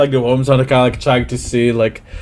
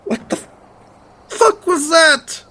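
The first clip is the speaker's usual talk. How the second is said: very high, slow, shaken cry; stunned horror, voice breaking upward; shocked. Acted. NPC shocked after worm removed.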